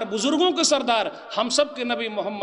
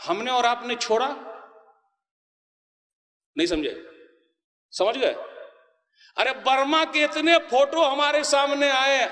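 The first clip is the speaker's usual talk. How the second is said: ordinary delivery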